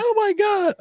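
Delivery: put-on very high shrieking girl voice; high shocked girls in the video.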